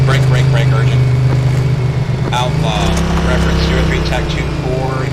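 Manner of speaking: loud, urgent break call over the radio. Real, not acted. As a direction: cut in fast and loud to break the net.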